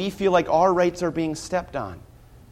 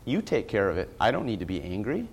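much lower and softer, letting the anger go; hushed prayer; 4 semitones lower, 8 dB quieter, faster than usual. Retold or imitated. A believer handing his wrong to God, hushed and low. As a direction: hand it over to God under your breath. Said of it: "You say".

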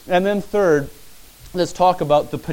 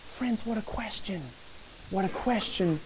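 near-whispered, much softer marvel; softly amazed aside; awed hush; 2 semitones higher, 12 dB quieter than usual. Real, not acted. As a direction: drop to a hush in awe. Marvels at Jesus' question again.

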